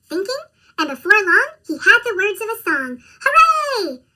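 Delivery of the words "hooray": high, loud hooray; excited cheer. Acted. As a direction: shout it out with joy. Goat cheers after writing song.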